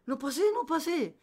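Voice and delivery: high, loud imitation of a girl; panicked mock shout. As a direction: yell it high as if blocking the door. Girl shouting at brother at door.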